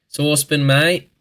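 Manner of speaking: broad Australian. Shown as a demonstration. Vlogger hamming up Aussie speech for viewers, broad Australian accent. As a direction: play up the Australian drawl on "Saucepan". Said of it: "Why do we do this as Australians".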